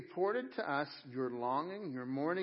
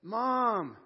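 long drawn-out mock wail, getting louder; boy yelling for Mom; 3 semitones higher, 5 dB louder than usual. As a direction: imitate a child calling his mother, stretching the word. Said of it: "he's yelling for his mom".

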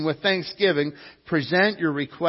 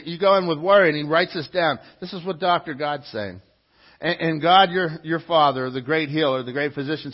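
normal talking voice

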